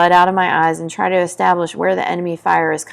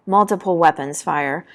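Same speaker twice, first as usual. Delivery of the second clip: usual speaking voice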